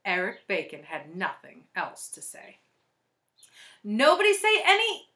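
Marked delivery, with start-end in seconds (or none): 3.8-5.1 high, loud warning cry; alarmed yell, cut off mid-word; urgent warning. A narrator's reading. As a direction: shout to warn the class. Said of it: "Jenny tried to warn".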